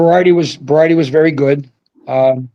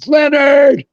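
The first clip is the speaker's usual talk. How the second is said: high, loud call across the concourse; yelling a name; 11 semitones higher, 3 dB louder, slower than usual. Retold or imitated. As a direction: bellow the name down the hall. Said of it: "He's yelling".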